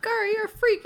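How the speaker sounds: mock taunt.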